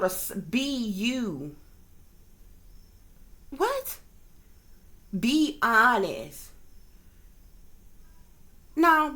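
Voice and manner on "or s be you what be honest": drawn-out mock emphasis on "be"; slow it down, lean on "be"; slow pointed quote. Vlogger imitating coded words with slow stress. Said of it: "or truth be told".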